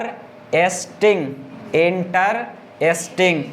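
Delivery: Interesting slowly as इन, टर, एस्टिंग; sounding out interesting to count syllables. Shown as a demonstration. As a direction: pause inside interesting between its parts. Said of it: "इसमें भी हो जा रहा है कै गो".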